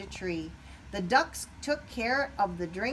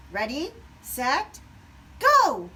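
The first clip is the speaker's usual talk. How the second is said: building to a loud, higher go; called start; 6 semitones higher, 9 dB louder, slower than usual. A narrator's reading. Pete starting the obstacle course, building call. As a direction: call the race off. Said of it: "Pete called out".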